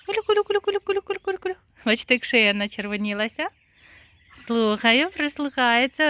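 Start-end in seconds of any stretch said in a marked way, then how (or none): 0.1-1.6 high sing-song calling to poultry; high repeated call to the turkeys. Real, not acted. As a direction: call the birds in with a high chant.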